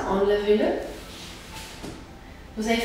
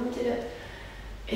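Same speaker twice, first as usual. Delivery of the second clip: slow fading whisper; 9 dB quieter, much slower than usual. Shown as a demonstration. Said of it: "coach cueing, repeated word fading".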